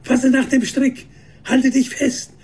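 high, urgent shouted instruction; calling out. A narrator's reading. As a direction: call down to someone in danger. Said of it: "rief er Nuseira zu".

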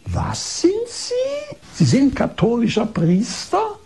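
very high, drawn-out aghast question; imitated shocked disbelief. Doctor backed against wall on hearing he's a priest, shocked. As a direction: recoil in mock horror, voice shooting up. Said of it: "als ob sie einen Schlag bekommen hätte".

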